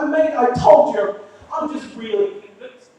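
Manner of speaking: frustrated comic insisting to a stubborn listener; exasperated. Retold or imitated. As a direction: throw up your hands and insist. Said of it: "I'm like".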